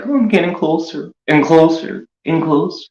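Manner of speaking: high, creeping menace closing in; creepy stalker voice. Retold or imitated. Mocking Tonic as he creeps up close on the streamer. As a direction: taunt like a horror villain sneaking up. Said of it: "and then Tonic, like".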